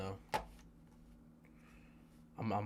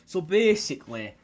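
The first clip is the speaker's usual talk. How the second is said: loud reveal; 8 semitones higher, 13 dB louder than usual. Real, not acted. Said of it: "revealing his heritage".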